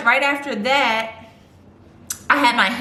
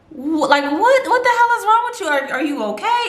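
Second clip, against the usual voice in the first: alarmed friend; 9 semitones higher than usual. Retold or imitated. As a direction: play the friend panicking at hearing her cry.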